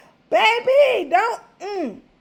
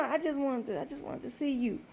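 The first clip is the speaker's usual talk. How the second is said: much softer, mumbled reply; quoted man, soft; 4 semitones lower, 15 dB quieter than usual. Retold or imitated. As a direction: say it low like a sheepish guy. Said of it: "He told me".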